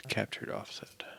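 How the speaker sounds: breathy murmur, reading off the code; whispered aside. Real, not acted. Coder reading a variable name aloud, whispered. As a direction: say it under your breath to yourself. Muttering to himself while coding.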